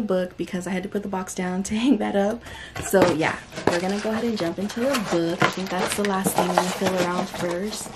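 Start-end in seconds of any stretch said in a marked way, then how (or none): none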